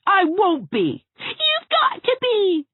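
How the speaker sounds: put-on high, loud children's squabble; shrill sibling fight; screaming quarrel. A narrator's reading. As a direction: yell back and forth. Twins screaming at each other.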